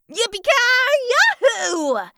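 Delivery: high, drawn-out whoop; cowboy holler. A narrator's reading. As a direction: holler it joyfully out the window. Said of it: "he shouted out the window".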